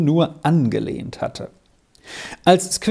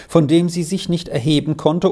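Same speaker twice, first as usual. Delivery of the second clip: usual speaking voice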